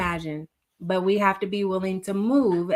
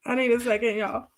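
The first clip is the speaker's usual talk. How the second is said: real tearful voice, holding back sobs; fighting to compose oneself through tears; crying; 3 semitones higher, faster than usual. Real, not acted. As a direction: choke back tears and ask for a moment. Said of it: "I did not mean to cry".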